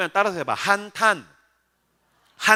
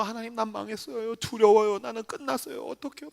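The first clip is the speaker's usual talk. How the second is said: hushed, fearful private prayer; acted helpless, quiet pleading; very frightened; 8 dB quieter than usual. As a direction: whimper softly to God in despair. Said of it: "혼자 너무너무 두려워서".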